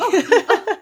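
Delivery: squealing giggle; high laugh. Real, not acted. Laughs at the compliment.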